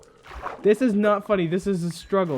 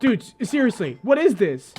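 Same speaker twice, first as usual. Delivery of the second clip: ordinary talk, nothing marked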